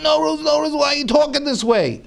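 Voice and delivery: high, hurried pleading wife; wife pleading with her husband; alarmed pleading. Retold or imitated. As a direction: beg him to stop.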